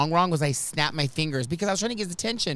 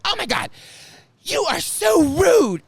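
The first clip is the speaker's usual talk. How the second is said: loud scolding shout; 11 semitones higher, 6 dB louder than usual. Retold or imitated. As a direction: bellow it out so the whole room turns. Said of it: "and so loud".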